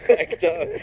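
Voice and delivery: high squealed what-the-fuck through laughter; mock disbelief. Retelling the XO flipping him off, high laughing disbelief. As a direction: squeak it out in fake shock, laughing.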